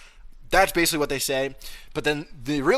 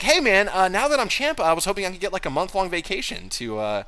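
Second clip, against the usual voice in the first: higher, lazy asking voice; mock lazy Dom; 6 semitones higher than usual. Voicing Dom asking for vacation, high and lazy. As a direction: ask for a favour like a lazy kid.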